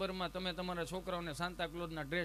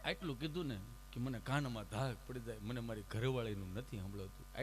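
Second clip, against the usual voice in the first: ordinary talk, nothing marked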